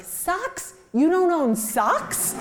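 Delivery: high-pitched, drawn-out disbelief; incredulous sister. Retold or imitated. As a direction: question it in comic outrage, pitched high. Retold disbelief at brothers' shopping.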